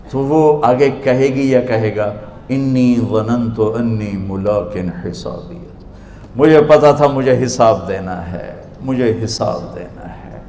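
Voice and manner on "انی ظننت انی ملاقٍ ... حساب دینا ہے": soft, low, slow recitation; quiet, reverent acted answer; hushed reply. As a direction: answer gently and slowly, letting it sink. Acts the saved soul's answer.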